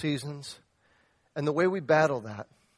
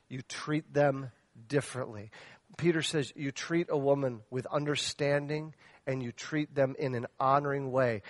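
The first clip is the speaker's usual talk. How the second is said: normal talking voice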